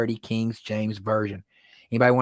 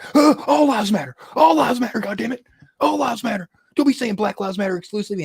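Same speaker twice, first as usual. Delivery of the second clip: high, loud indignant protest; hammed-up outraged chanting; mock outrage; 10 semitones higher, 5 dB louder than usual. Mimicking indignant objectors.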